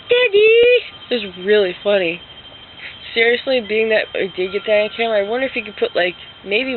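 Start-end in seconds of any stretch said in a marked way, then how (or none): 0.1-0.5 put-on high, loud toddler squeal; little sister. Sleepy sister sees her dad, high toddler cry. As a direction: squeal it like a woken small child. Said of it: "And my sister's like".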